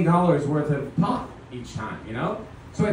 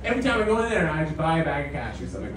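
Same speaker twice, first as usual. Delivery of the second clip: usual speaking voice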